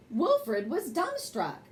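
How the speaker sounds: ordinary delivery, nothing marked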